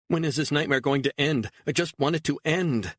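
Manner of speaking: high, pleading despair; desperate. Acted. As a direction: cry out that it must stop. Beatrice despairs, wants nightmare over.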